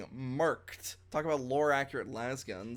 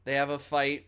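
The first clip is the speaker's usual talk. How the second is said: usual speaking voice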